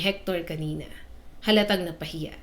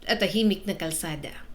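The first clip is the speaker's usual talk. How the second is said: plain reading voice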